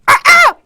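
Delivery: very high, loud "ah, ah"; two piercing crowing yelps; taunting yelp. Real, not acted. Crowing as the room goes quiet.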